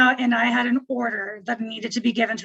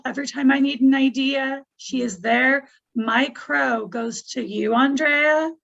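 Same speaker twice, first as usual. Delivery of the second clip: ordinary talk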